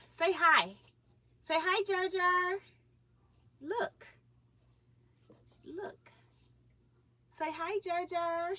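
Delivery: high sing-song prompting. Real, not acted. Prompting baby to greet.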